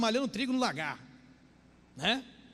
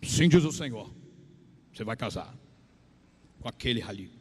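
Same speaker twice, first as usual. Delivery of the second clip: low, halting fake oracle; hammed-up prophecy with solemn pauses; mock false prophet; 10 semitones lower, 3 dB louder than usual. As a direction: start loud, then drop very low and portentous.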